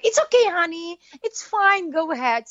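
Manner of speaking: Nadia reassuring. Retold or imitated. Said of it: "And she's like".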